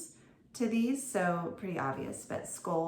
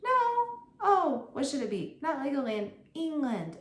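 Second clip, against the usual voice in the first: playful prompting; 8 semitones higher, 6 dB louder than usual. Pretend. Teacher prompting kids.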